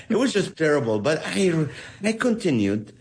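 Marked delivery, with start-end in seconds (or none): none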